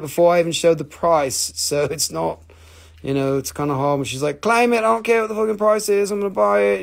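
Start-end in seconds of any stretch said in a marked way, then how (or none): 4.4-6.8 higher, louder mock insistence; imitated eager buyer. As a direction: blurt it like an impatient buyer, pitched up. Acts out eager buyer's quote.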